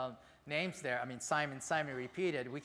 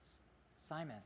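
yearning plea. Shown as a demonstration. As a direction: let Simon fade into a tender plea. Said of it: "this is how Jesus addressed him".

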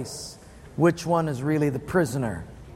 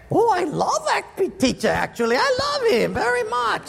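high-pitched, exaggerated affection; gushing turnaround; 13 semitones higher than usual. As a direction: gush insincerely, squealing high. Student gushes after getting money.

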